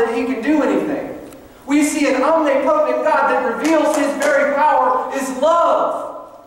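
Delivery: high, ringing, impassioned pulpit voice; full-force preaching. Real, not acted. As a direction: build fervently, driving home each phrase.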